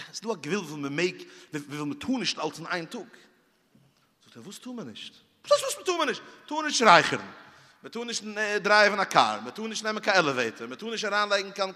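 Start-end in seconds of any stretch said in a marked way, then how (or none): 4.4-5.1 quiet, understated retold query; retold soft question. Retold dialogue, puzzled question. 5.4-7.2 high-pitched, indignant comeback; exasperated retort. Retold or imitated. Retold exasperated retort to question.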